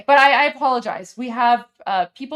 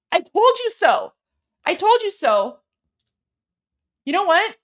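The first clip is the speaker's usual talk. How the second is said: higher, heated yelling at Doug Ford; furious rant; 8 semitones higher than usual. Real, not acted. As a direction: let the anger boil over. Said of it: "chill out".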